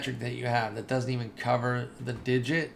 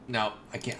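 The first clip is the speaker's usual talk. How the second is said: ordinary talk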